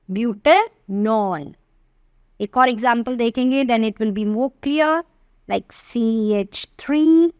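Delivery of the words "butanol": slow spelled-out name. Shown as a demonstration. Teacher dictating the answer to students, very slowly. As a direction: stretch "butanol" out syllable by syllable, very slow.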